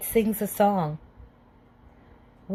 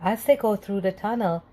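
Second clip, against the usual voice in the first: plain reading voice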